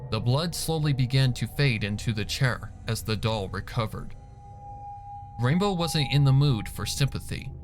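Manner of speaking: ordinary delivery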